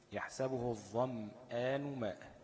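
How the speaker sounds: drawn-out tajweed reading; slow Quran recitation. Shown as a demonstration. Teacher recites the example verse for the class, very slow. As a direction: recite it very slowly and carefully. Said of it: "زي إيه؟".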